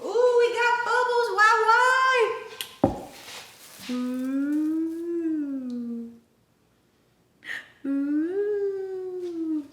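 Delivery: high delighted squeal; excited squeal. Real, not acted. Delighted at bubbles in dough.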